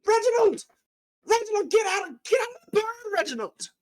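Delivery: very high, loud, frantic little gnome calling; tiny gnome yelling for Reginald. Acted. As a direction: shriek after the runaway robot. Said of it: "and he's like".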